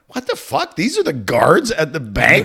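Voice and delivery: jumps high on the first words, then slow; high incredulous outburst; startled disbelief. Retold or imitated. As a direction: thrown by kid soldiers guarding the bank. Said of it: "I'm like".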